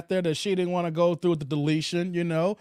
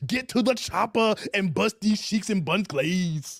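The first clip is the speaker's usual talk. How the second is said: Arnold Schwarzenegger impression. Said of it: "Arnold Schwarzenegger".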